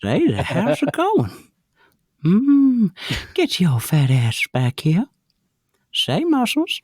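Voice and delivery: very high, thin old-man cartoon voice; high character voice, drawn out; Herbert impression. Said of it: "I do have Herbert".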